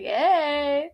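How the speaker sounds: playful, flirty hey; flirty teasing greeting. Acted. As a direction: greet him with a wink in the voice. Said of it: "Wink, wink".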